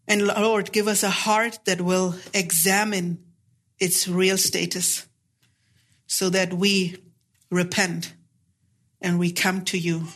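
hushed, reverent prayer; pastor's prayer. Acted. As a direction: pray humbly for the whole church. Leads the congregation in prayer.

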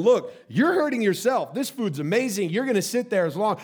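exasperated dad. Retold or imitated. Dad coaxing daughter to eat, exasperated.

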